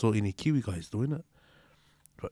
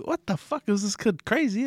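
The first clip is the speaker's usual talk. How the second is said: put-on pitched-up incredulous cry at the kid's nerve; amazed disbelief; 9 semitones higher than usual. Mates recalling the fight, mock amazed outburst. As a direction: sound stunned that a kid would square up to Wally.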